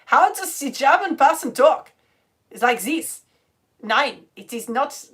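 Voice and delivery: loud, slow mock German accent; overdone German accent, word by word; attempted German accent. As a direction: ham up a bad German accent. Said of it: "I can't do the German accent".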